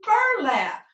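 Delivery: "Burlap" in one high-pitched call, falling at the end; "Burlap" run together, high on bur; blended whole word. Shown as a demonstration. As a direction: lead the class in a joined-up "Burlap". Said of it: "let's do it all together".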